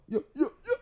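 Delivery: high, loud hype greeting with a laugh; excited greeting. Acted. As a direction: yell it out like a show host. Show opening greeting.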